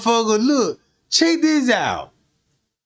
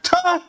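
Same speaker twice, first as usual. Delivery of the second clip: tuh; 10 semitones higher than usual. Retold or imitated. Dismissive scoff in retold argument.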